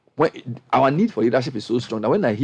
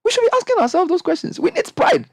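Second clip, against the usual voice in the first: impassioned outburst. Real, not acted. Impassioned plea for African pride.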